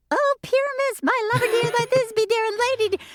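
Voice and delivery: very high, mock falsetto lines; high falsetto Flute. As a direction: squeak it out like a man playing a lady. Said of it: "Steve had the bassest bass voice".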